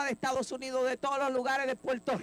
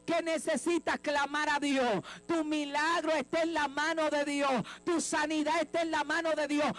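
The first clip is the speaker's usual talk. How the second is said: fiery preaching; 4 semitones higher than usual. Acted. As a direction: preach it out to the whole church at full force. Preaching call and response.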